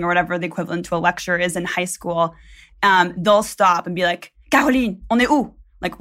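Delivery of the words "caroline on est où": higher, sharp classroom call in French; stern French teacher. Retold or imitated. French teacher putting a pupil on the spot, stern demand.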